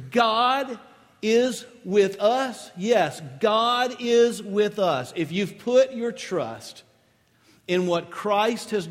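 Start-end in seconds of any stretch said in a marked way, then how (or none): 0.1-5.1 preacher's ringing emphasis; stress on is. Shown as a demonstration. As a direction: hammer the word is, slowly.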